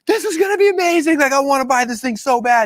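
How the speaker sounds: very high-pitched, breathlessly eager fan reaction; hammed-up squeal of excitement; gushing excitement. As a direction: gush like you're desperate to buy it. Imitates own gushing reaction to pitch.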